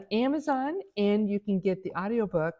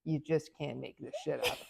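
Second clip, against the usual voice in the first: amused low aside; 6 semitones lower, 10 dB quieter than usual. Retold or imitated. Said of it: "He goes".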